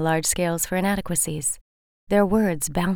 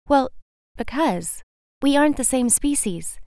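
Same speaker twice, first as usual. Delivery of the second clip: butterfly refusing the moth, higher and haughty; 6 semitones higher than usual. Acted. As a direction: turn up your nose at him. Butterfly character voice.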